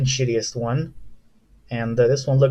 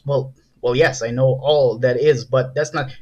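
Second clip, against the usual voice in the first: ordinary talk, nothing marked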